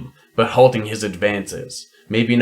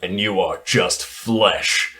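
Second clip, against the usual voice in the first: cold menacing voice; 3 semitones lower, 4 dB quieter, slower than usual. Acted. Sycamore's cold reply.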